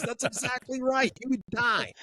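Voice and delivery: high-pitched, amused mock agreement; hammed-up emphatic confirmation, drawn out slowly; Russian guy. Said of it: "The guy goes".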